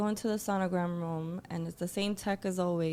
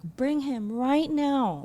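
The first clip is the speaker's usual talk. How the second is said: loud, high, slow yell, every word drawn out; retold yelling; 7 semitones higher, 6 dB louder, slower than usual. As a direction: yell it at the staff, demanding. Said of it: "I start yelling".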